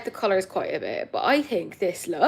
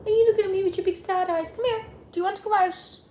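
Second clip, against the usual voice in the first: high, fast pet voice for the dog; 10 semitones higher, faster than usual. Real, not acted. Talking fondly to her dog.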